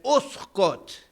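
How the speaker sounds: sharp loud stern command; stern shout. Retold or imitated. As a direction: silence the crowd with one stern word. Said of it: "stern voice".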